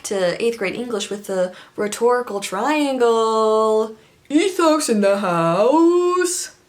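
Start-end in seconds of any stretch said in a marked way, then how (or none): 4.3-6.5 slow, high showman call; hyped announcement. Pretend. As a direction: announce it like a game show host. Mock announcer flourish.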